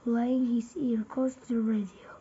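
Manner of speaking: ordinary delivery, nothing marked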